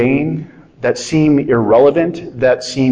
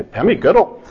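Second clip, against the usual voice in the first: higher-pitched Scottish lilt; Scottish accent; 6 semitones higher than usual. Shown as a demonstration. Showing off his only Scottish impression, Scottish accent. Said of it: "the only Scottish accent I can do".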